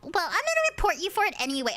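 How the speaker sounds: high, fast, snotty imitation; mocking spiteful teammate. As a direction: mimic a petty teammate making threats.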